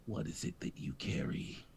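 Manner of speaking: low, sinister whispered villain; menacing whisper. Acted.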